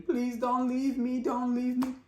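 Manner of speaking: high, slow pleading; begging. Retold or imitated. Husband begs his wife not to leave, high slow pleading. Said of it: "and he is begging".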